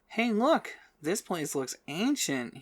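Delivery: high, thrilled exclamation; ecstatic. A narrator's reading. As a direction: burst out with delight. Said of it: "sounding ecstatic".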